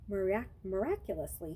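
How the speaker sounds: plain reading voice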